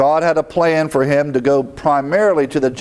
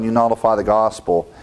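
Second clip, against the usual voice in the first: ordinary talk, nothing marked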